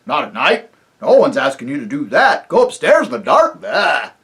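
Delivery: jovial rustic chief, laughing as he talks; chief of the invisible folk. Acted. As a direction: boom it out like a jolly simple village chief. Chief voice scoffs.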